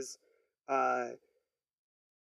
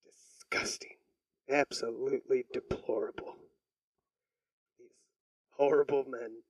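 whispered mock disgust; 4 semitones lower, 5 dB quieter than usual. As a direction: hiss it out in fake horror. Mock outrage after the story.

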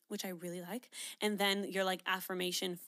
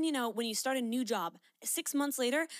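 ordinary talk, nothing marked

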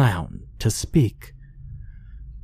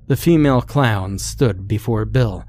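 ordinary delivery, nothing marked

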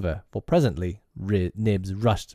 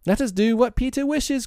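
put-on high, childlike cry of obedience; high-pitched boyish voice; boys crying out. A narrator's reading. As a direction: pipe up like simple little boys. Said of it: "cried the simple boys".

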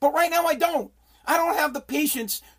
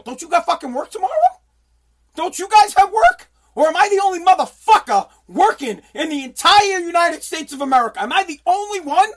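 high, loud, incredulous demands; exasperated rant; 6 semitones higher, 5 dB louder than usual. Real, not acted. Venting at friends who want to chill on a work night, exasperated. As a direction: fire off indignant questions, voice pitched way up. Worked-up rant about being only one working.

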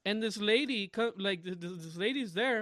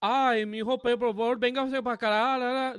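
Colombian Spanish; 3 semitones higher, 4 dB louder than usual. Acted. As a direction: imitate a Colombian chattering in Spanish. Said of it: "hear Colombians where they're like".

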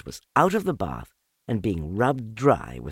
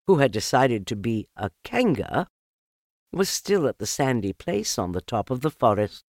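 ordinary delivery

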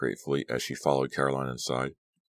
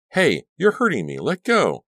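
high, loud cry to let go; pained protest; 12 semitones higher, 9 dB louder than usual. Acted. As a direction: yelp it out, hurt and pleading. Arm gripped painfully.

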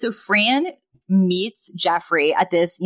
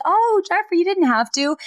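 high, gushing mock surprise; gushing Fran; 10 semitones higher than usual. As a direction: coo it like a flattered date. Fran gushing over gift.

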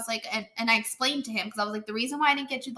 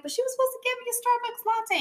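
put-on higher protesting boy voice; imitating a complaining kid; 12 semitones higher than usual. Friend mimics Michael wanting his latte, higher kid voice. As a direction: lightly mimic a disappointed child. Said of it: "he was like".

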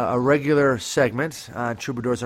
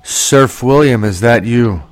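calling out; 3 semitones lower, 10 dB louder, slower than usual. Pretend. Hailing guest over radio link.

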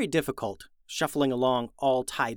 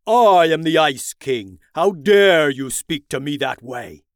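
much louder booming royal rage; furious king; 10 dB louder than usual. Acted. Ice King enraged at the cheeky brothers, loud booming fury. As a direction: thunder down at the insolent boy.

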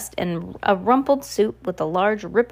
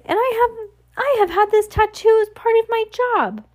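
high, pleading protest; Jacques crying out; 13 semitones higher than usual. A narrator's reading. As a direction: plead your case far above your usual pitch. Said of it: "Jacques cried".

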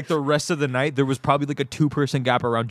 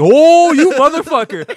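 high loud yelp of alarm; sudden shocked shout; surprised outburst; 12 semitones higher, 11 dB louder, slower than usual. Retold or imitated. Knee coming at his face.

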